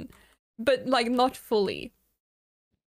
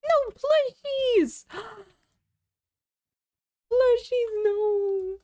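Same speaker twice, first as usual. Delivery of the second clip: mock begging; 9 semitones higher, much slower than usual. Begging as bed falls.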